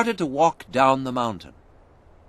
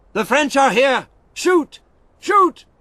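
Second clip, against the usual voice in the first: much higher, loud alarm shout; 7 dB louder than usual. A narrator's reading. An English soldier on the wall shouting to fire. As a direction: yell the warning from the wall. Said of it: "An English voice shouted".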